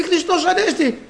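high-pitched excited exclamation; joyful. Acted. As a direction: act out a grandmother jumping up with joy. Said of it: "πετάχτηκε πάνω και τρέχει χαρούμενη". (χαρούμενη, ψηλή φωνή γιαγιάς)

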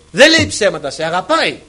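loud, forceful preaching; emphatic proclamation. Real, not acted. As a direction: drive the assurance home loudly. Same assurance repeated at full force.

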